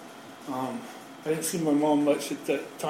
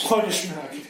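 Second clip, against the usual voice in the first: ordinary delivery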